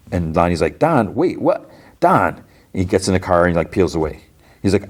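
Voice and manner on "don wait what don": higher-pitched, baffled mock protest; retold alarmed calling. Gang member to his fleeing leader, retold alarmed call. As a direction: call after someone, lightly acting disbelief. Lonnie calls after fleeing Don.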